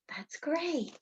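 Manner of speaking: soft unvoiced whisper; whispered praise. Real, not acted. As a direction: breathe the praise, no voice. Praising a child's answer softly.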